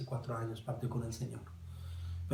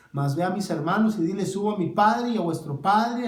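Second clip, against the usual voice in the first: fervent proclamation; 6 semitones higher, 7 dB louder than usual. Acted. Jesus's message voiced with fervor.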